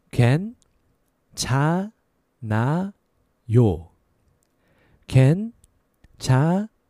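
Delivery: slow, syllable by syllable for learners; slow careful repetition. Shown as a demonstration. Teacher drilling students slowly. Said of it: "And a little faster".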